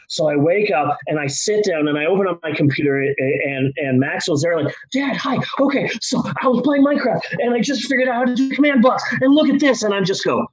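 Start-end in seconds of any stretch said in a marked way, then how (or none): 4.9-9.8 put-on high, rushed child's chatter; eager kid. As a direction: gush it like a child showing off. Imitates eager child about Minecraft.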